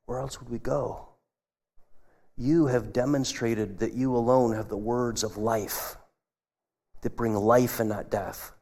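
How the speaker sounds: soft, earnest reply of devotion; sincere Peter. Retold or imitated. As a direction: say it quietly, fully committed. Peter answers Jesus.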